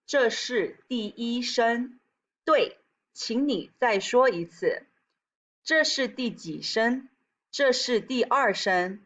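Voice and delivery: slow, measured Mandarin for learners; teacher modelling phrases slowly; slow model reading. Shown as a demonstration. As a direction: say each syllable slowly and separately. Teacher models classroom phrases in Chinese.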